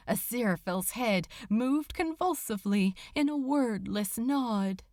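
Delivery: ordinary delivery, nothing marked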